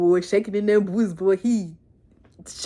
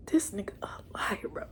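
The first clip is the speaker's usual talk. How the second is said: real conspiratorial whisper; 14 dB quieter than usual. Real, not acted. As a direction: lean in and whisper it as a secret. Aside to the audience.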